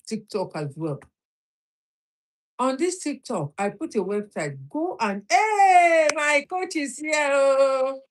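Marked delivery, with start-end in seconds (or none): 5.3-8.0 much higher and louder, delighted cry; excited shout. Real, not acted. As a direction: call out with joy at seeing the coach. Spots her coach joining live.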